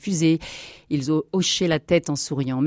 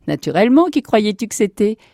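higher, louder hearty reply; joyful greeting; 6 semitones higher, 6 dB louder than usual. Acted. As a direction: answer with delighted assurance. Brother greets him joyfully.

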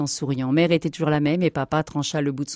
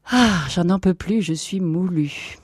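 slow, weary sigh into words; exhausted; 3 semitones higher, slower than usual. Acted. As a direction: drag it out, worn out. Exhausted at end of day.

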